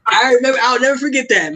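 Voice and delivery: talking high through laughter; laughing. Real, not acted. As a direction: still cracking up as he speaks. Laughing while recalling the line.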